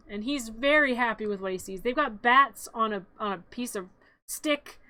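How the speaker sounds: usual speaking voice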